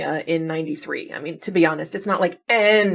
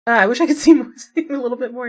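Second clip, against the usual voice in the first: higher and louder, laughing through the words; real amused laugh mid-sentence; laughing wish; 6 semitones higher, 7 dB louder, faster than usual. Real, not acted. Laughing at blurry photo.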